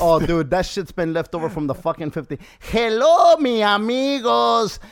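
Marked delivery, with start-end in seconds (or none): 2.6-4.8 loud, high, drawn-out Mexican caricature; slow, hammed-up theme-park Mexican host; mock Mexican accent. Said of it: "people think that a lot of Latinos or Mexicans sound".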